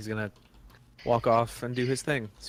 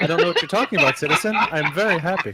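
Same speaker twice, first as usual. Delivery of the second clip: lightly acted cheery denial, louder and higher; a hint of forced in-game cheer; mock-happy citizen; 5 semitones higher, 7 dB louder, faster than usual. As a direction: insist on happiness, laughter creeping in. Insists on happiness in character.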